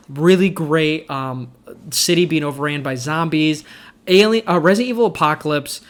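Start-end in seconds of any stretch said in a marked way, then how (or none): none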